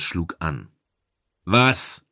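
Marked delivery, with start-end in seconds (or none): 1.4-2.1 mildly raised, brusque question; loud, brusque. A narrator's reading. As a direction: ask it curtly, a touch loud. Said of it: "fragte er laut".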